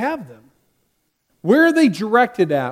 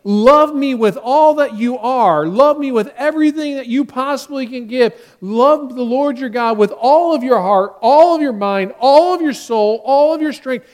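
louder, higher, slowed-down pleading; fervent voice of Jesus; 4 semitones higher, 5 dB louder than usual. Acted. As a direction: plead it out loud as Jesus, every all hit hard. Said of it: "Jesus is saying".

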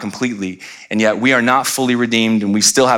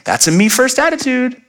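high, sing-out parental scolding; parent to children; 13 semitones higher than usual. Retold or imitated. Parent naming the children's selfishness, high mock scolding. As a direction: call out the behavior in a raised pitch. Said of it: "I use for our children".

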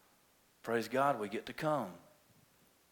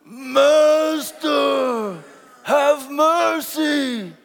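high, slow leper wail; 9 semitones higher, 6 dB louder, much slower than usual. Shown as a demonstration. Preacher leading the last try at lepers' plea, wailing high and slow. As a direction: call for mercy in long, high cries. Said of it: "this is leprosy".

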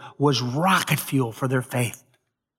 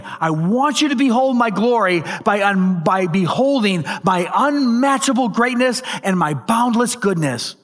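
higher-pitched, impassioned declaration; proclaiming; 6 semitones higher, 3 dB louder than usual. Acted. Preacher voicing Jesus revealing his glory, proclaiming. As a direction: proclaim it with rising fervor, stretching the last words. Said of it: "He's saying".

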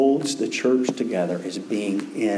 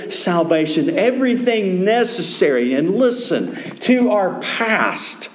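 higher-pitched, sustained sermon delivery; emphatic preaching; 6 semitones higher than usual. Real, not acted. Preacher telling the church of salvation in Christ, emphatic preaching. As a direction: preach it in building, weighted phrases.

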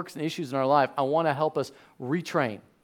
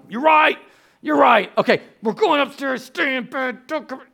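high, loud, panicked agreement turning to orders; frantic dad; 8 semitones higher, 4 dB louder than usual. Retold or imitated. Dad obeying his wife and sending kids to bed, frantic shouting. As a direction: give in loudly, then order the kids off in a raised pitch.

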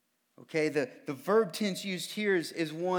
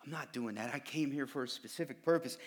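quiet firm refusal; 5 semitones lower, 9 dB quieter than usual. Retold or imitated. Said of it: "And Jesus said".

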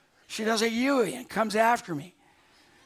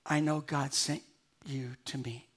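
hushed, tearful quote, much softer and lower; 5 semitones lower, 4 dB quieter than usual. Retold or imitated. Stranded woman thanking Lois, a touch tearful. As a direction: retell her grateful words softly, near tears. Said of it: "With tears in her eyes".

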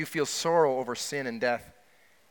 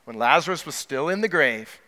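usual speaking voice

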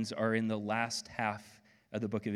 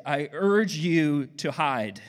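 louder, slower plea to hide; "I urge you to hide" pushed hard and slow; mock urging; 3 semitones higher, 7 dB louder, slower than usual. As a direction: play the scared prisoner pleading. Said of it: "I would say".

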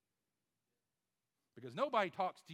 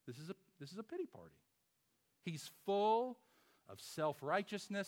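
ordinary delivery

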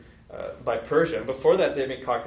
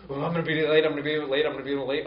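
hurried mock panic, higher and louder at first, then fading; 4 semitones higher, 4 dB louder, faster than usual. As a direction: act out rushing late in a fluster, calming down. Retold panic about being late.

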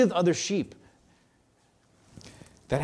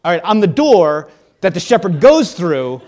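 louder, exasperated try again; frustrated re-explaining; 3 semitones higher, 10 dB louder than usual. Acted. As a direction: start over like a teacher losing patience.

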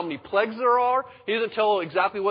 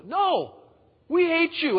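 hostile crowd; 11 semitones higher, 3 dB louder, much slower than usual. Acted. People rejecting Moses.

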